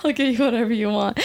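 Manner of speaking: a touch of doting sweetness; indulgent. Retold or imitated. Wife promising her post-surgery husband anything, lightly doting. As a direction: give in to him completely.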